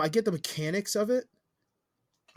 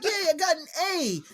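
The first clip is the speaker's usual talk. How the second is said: put-on excited kid; 10 semitones higher than usual. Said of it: "like, your kid brings you the test".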